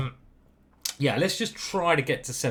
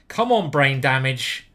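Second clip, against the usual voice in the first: slow loud cheer; 8 dB louder than usual. Real, not acted. Cheering his own opening.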